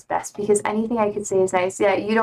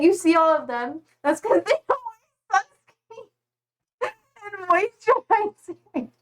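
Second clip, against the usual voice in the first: lecturing mom; 9 semitones higher than usual. Retold or imitated. Nurse mom lecturing about sunscreen.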